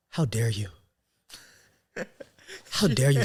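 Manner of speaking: dramatic, hushed outrage with heavy pauses; acted indignation. Teacher finally spots her old troublemaker, acted indignation. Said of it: "I'm about to get in my acting bag".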